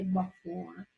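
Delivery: before with the E barely sounded; teacher showing her own before; reduced E. Shown as a demonstration. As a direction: say before dropping the E. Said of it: "I don't pronounce the E".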